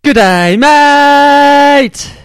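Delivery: high, loud Aussie greeting; laconic outback drawl. Retold or imitated. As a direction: belt it like a cheery New Zealander. Reading friend's email aloud.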